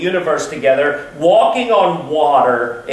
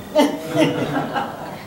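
a quiet airy chuckle; soft breathy laugh; 7 semitones higher, 5 dB quieter than usual. Real, not acted. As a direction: let out a hushed laugh. Laugh after raccoon reveal.

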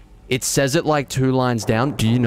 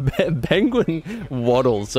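laughing; 4 semitones higher, slower than usual. Real, not acted.

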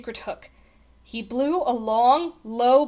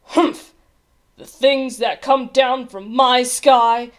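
loud, disgusted royal grumble; grumbling king; 3 semitones higher, 9 dB louder, slower than usual. A narrator's reading. Old king fuming at the sky, loud grumbling. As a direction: grumble it slowly and loudly, full of scorn. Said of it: "muttering and sputtering through his royal whiskers".

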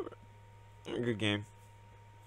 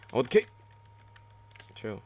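normal talking voice

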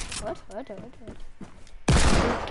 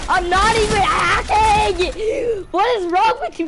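loud, high, slow protest; indignant yelling; 6 semitones higher, 5 dB louder than usual. Real, not acted. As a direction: yell it like he's been wronged. Accused of cheating, protests.

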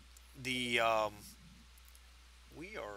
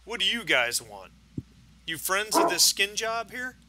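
lightly voiced hostile neighbor, pitched up; confrontational neighbor; 9 semitones higher, 6 dB louder than usual. Burly neighbor accosts detectives at the apartment door, confrontational. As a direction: challenge them, voice climbing at the end.

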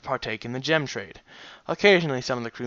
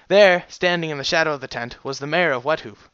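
ordinary delivery

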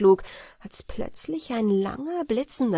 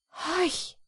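a sudden high alarmed hush; startled fairy calling out; 9 semitones higher than usual. Acted. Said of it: "rief sie plötzlich".

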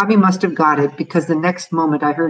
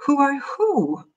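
puzzled question pitched high; mother, confused, echoes the question back; wondering; 10 semitones higher, slower than usual. A narrator's reading. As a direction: ask it as if baffled. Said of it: "Mother wondered".

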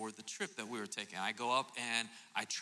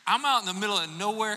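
usual speaking voice

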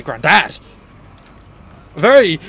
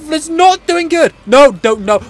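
ordinary talk, nothing marked

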